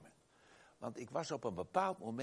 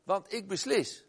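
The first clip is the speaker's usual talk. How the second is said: mock defiance; 6 semitones higher, 9 dB louder than usual. Preacher mocks a self-centred attitude.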